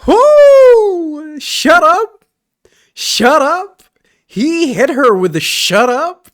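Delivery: loud, high whooping cheer; excited cheering. Real, not acted. Player cheering at the screen, loud and high. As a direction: yell it out in delight.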